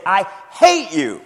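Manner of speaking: loud, slow shout of I hate. Shown as a demonstration. Preacher demonstrating a shout. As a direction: shout it with an exclamation point. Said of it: "how would you shout".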